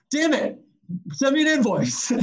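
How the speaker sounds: high-pitched mock exasperation; imitated frustrated client relenting; exasperated giving-in. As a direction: retell a client caving in, pitched well up. Retold client gives in to price.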